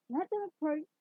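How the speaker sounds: woman calling an order from a carriage. A narrator's reading. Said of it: "the woman's voice called from the carriage".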